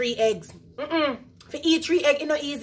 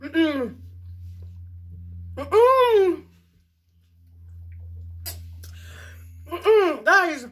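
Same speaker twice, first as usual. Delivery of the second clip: humming through a mouthful of egg; mm-ing while chewing; mouth full; 4 semitones higher, much slower than usual. Real, not acted. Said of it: "You can't talk and eat egg same time".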